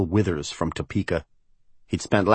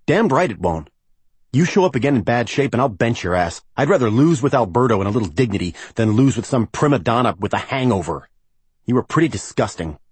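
higher, emphatic telling-off; angry scolding; 4 semitones higher, 3 dB louder than usual. Acted. Coach threatens to bench his hungover player, angry scolding. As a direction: lay into him with punched key words.

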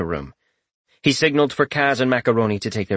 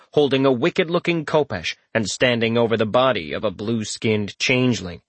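ordinary delivery, nothing marked

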